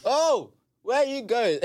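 slow, high, loud, laughing call; shouting sister. Retold or imitated. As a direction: laugh while calling out like the sister.

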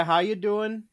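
lightly put-on casual hello; a hint of a folksy greeter; casual greeting. Shown as a demonstration. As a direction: toss out a breezy acknowledgement in passing.

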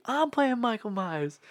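high, slow hammed-up insistence. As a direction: insist it like an offended diva. [name] insists he is [name].

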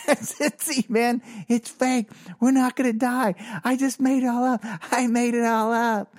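high, insistent mock explaining; lightly squeezed high voice, talking them down; high-pitched acted reassurance. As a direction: imitate pleading with startled classmates. Acts himself explaining the prank.

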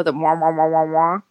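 muffled wah wah teacher drone; Charlie Brown's teacher. Retold or imitated. Imitating Charlie Brown's teacher droning on. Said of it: "Charlie Brown's teacher".

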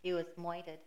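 a hint of New York, murdered as moited; mock tough New York mumble; mock New York accent. Co-host jokes about murder in New York accent. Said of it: "Midwesterner trying to do it in a New York accent".

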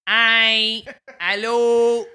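bright, chatty Quebecois French accent on the names. Shown as a demonstration. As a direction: greet like a Montrealer in Quebecois French. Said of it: "the Quebecois accent is a lot more".

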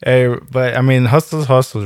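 normal talking voice

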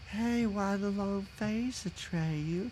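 slow, soft, lazy drawl; laid-back and unbothered, dragging it out; chill Empress. Retold or imitated. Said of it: "this is her voice".

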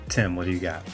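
usual speaking voice

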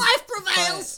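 mock shout. Mocking the Goldblum quote.

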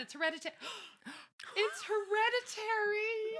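gasping, high, stretched-out wail; dawning realization. Retold or imitated. Podcaster acting Roger's birthmark realization, overwhelmed.

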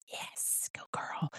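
soft whispered cheer; whispering. Real, not acted. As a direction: whisper it as an aside. Whispered aside during intro.

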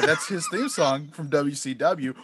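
ordinary delivery

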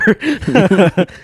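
loud high cackle; laughing hard at the memory; high laughter. Real, not acted. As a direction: crack up laughing. Laughing at own story.

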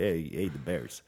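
hammed-up Chicago sports fan accent, slow; a bad Chicago accent cheering the Bears; mock Chicago accent. Mocking a Chicago fan to his co-host, in a bad Chicago accent. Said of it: "I can't do the Chicago accent".

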